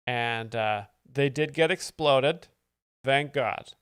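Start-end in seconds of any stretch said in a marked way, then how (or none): none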